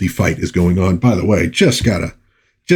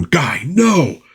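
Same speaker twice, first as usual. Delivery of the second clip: mock scream; 7 semitones higher than usual. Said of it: "General Glory screaming at him".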